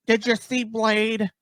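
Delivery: high, slow, drawn-out parody of an overexcited YouTuber; mocking trailer breakdowns. Imitating YouTubers who drive him nuts, mock revelation. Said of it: "people are driving me nuts".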